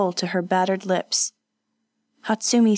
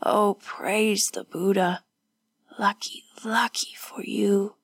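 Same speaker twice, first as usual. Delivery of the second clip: weak, breath-heavy relief tinged with sorrow; sad, distressed. A narrator's reading. Wounded woman glad for her friend, sadly distressed. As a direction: start slow, fade to a whisper. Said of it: "held a strange sadness and distress".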